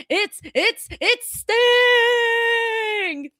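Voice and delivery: high cry with a long-held name; excited cheer. Retold or imitated. Streamer reading a fan's message, gleeful drawn-out cheer. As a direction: sing out the name, stretched long.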